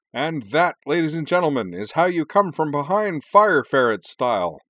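high, loud play-by-play crowing; showy ringside declaration; sports announcer. A narrator's reading. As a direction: proclaim it to the whole arena like a commentator. Kenji declares on radio sports broadcast.

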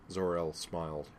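ordinary delivery

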